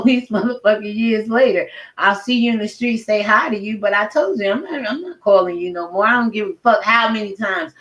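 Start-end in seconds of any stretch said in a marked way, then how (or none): none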